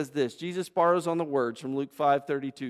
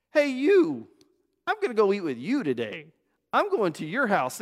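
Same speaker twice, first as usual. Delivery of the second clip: high, loud call of "Hey, you" that settles down; Jesus calling out; 5 semitones higher, 4 dB louder than usual. Acted. Jesus calling up to Zacchaeus, high and loud. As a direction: call up into the tree, friendly and bold.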